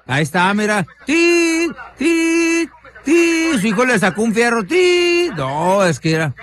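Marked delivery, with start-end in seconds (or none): 1.0-5.3 mock hit noises. Mimicking blows in the video.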